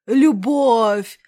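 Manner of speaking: «Любовь!» громче, на подъёме чувств; выкрикни «Любовь» торжественно; громкий пафосный возглас. Acted. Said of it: "Он взялся за пафосные речи". (громко и пафосно)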